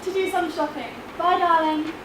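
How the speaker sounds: high bright stage-mum farewell; stage mother. Acted. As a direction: call a fond goodbye as the mum. Mother role in play excerpt.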